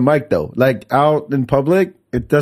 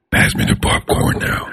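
slow breathy whisper; creepy whisper voice. Shown as a demonstration. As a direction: draw it out in a spooky whisper. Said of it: "You're like".

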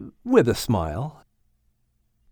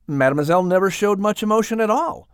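usual speaking voice

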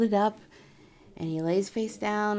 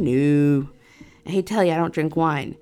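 emphatic refusal; 5 semitones lower, 6 dB louder than usual. Retold or imitated. Wine exchange.

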